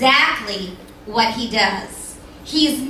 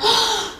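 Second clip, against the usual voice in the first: gasp; 10 semitones higher than usual. Acted. Crowd marvels at elephant bird.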